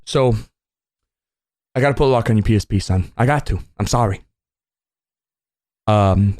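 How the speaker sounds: low, firm dad voice; stern dad. Retold or imitated. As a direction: lay down the law as the dad. Dad laying down rules.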